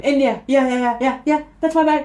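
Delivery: high, loud burst of yeahs; hammed-up excited answer; eager confirming. Eagerly confirms the bag is theirs.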